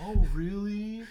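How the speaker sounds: high, slow, amazed; mock gasp of surprise. Acted surprised reply.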